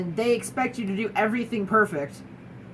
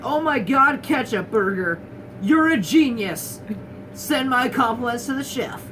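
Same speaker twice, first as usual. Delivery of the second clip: hammed-up amazement, louder and higher; mock gushing; 3 semitones higher, 4 dB louder than usual. Joking diner raving to a burger about ketchup, mock gushing. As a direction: make a mock fuss, as if raving over a masterpiece.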